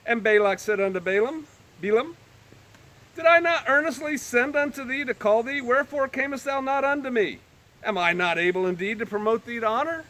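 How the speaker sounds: measured, lifted Bible reading; solemn King James reading; scripture reading. Acted. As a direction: read the verses with gravity. Reads scripture aloud.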